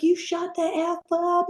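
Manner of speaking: high mock yell at a recruit; hammed-up drill sergeant shouting; retold yell. Drill sergeant screams at the chatty recruit, hammed-up. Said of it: "And he's like".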